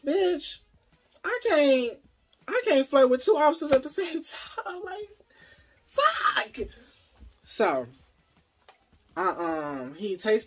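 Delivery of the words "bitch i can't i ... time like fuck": high, loud, laughing panic; blurting it out in a giddy panic; frantic. Real, not acted. Telling viewers about flirting with two officers, frantic and laughing.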